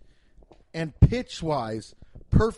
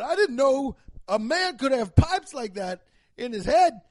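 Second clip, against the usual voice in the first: louder, higher, awed judge; 4 semitones higher, 6 dB louder than usual. Pretend. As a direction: declare the mistrial in wonder. Judge declares mistrial.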